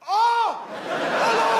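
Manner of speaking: squeaky high startled oh, hello; a fighter amazed his bomb worked, very high pitched; high surprised oh hello. Acted. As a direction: yelp way up high in delighted shock. Surprised fighter after explosion.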